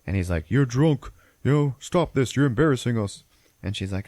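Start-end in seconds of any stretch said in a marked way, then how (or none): 0.5-3.2 raised, louder voice of an exasperated husband; mock a husband telling off his wife; retold scolding. Husband to drunk wife embarrassing guests, mock scolding.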